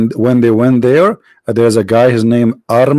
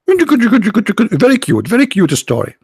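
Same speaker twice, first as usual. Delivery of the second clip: mock sweet; 6 semitones higher, slower than usual. Real, not acted. Mocking drawn-out remark.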